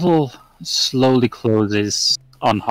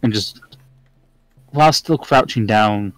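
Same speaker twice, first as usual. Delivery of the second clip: ordinary talk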